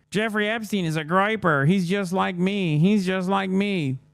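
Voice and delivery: slow, high, dopey fan voice; hammed-up slow, high fanboy impression; mocking a fan. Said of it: "This is another one of his fans".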